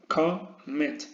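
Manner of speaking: "commit" split slowly, weight on the second syllable; stress on last syllable. Shown as a demonstration. Teacher showing where commit is stressed, end-stressed. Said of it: "El golpe de voz lo lleva a la última sílaba".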